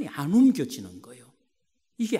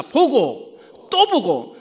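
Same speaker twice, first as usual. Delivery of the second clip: loud, very slow spaced words; call-and-repeat; 5 semitones higher, 10 dB louder, much slower than usual. Shown as a demonstration. Preacher has the congregation say it together, loud slow call-and-repeat. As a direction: lead the crowd word by word, loudly. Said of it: "같이 합니다".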